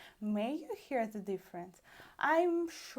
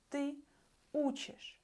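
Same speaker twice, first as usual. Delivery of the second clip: ordinary talk, nothing marked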